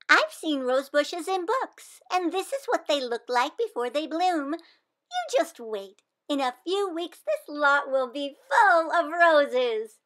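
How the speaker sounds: put-on earnest little girl sure of her plan; hopeful child; eager young Wanda. Wanda insists it's a rose bush.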